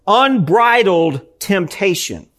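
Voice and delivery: UNBRIDLED stretched out slowly, word by word; stress on unbridled. Real, not acted. Preacher warning the congregation about sin, slow stress on "unbridled". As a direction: lean slowly and deliberately on "unbridled", then "temptation". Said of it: "I emphasize the word unbridled temptation".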